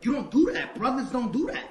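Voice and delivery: loud, high-pitched, emphatic protest; indignant objection. Retold or imitated. Man reacting to comments about his friend, indignant.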